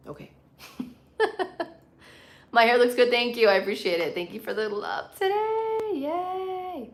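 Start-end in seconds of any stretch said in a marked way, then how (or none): 1.2-1.7 short high-pitched laugh. Real, not acted. Streamer between viewer comments, laughing. 5.2-6.9 drawn-out high-pitched cheer; high singsong cheer. Real, not acted. Streamer thanking viewers for the love, high cheering.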